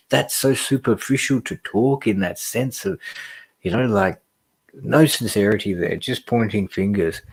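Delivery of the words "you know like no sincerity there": ordinary talk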